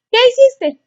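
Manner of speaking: hammed-up nosy mom asking her son about his day; prying parent. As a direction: pester the teen with a bright mock question. Pushy parent questioning teen.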